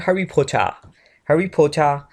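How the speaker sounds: "हैरी पॉटर" as "Harry Po-cha"; English-accent pronunciation. Shown as a demonstration. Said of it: "अगर हम pronounce करें तो इसको बोलते हैं".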